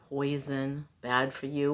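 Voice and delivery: normal talking voice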